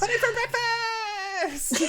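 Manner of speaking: put-on very high, loud kid shriek; yelling young child. Young son cheering for pudding at breakfast, shrieking with glee. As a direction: squeal it like an overexcited little kid. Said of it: "a young child's voice yelling".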